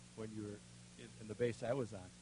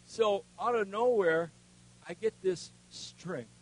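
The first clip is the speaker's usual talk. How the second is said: ordinary talk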